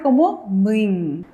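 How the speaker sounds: muin stretched slowly, ending in ng; model reading. Shown as a demonstration. Teacher demonstrates the syllable to the class, slow. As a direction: sound out muin slowly with back nasal. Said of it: "¿cómo va a sonar?".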